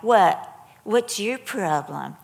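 loud opening, then drawn-out challenge; imitated teen defiance. Teens answering a mother's look, imitated defiance. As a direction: mimic a kid talking back.

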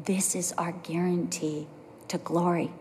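soft whispered promise. Shown as a demonstration. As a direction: breathe the words almost silently. Preacher drops to a hush.

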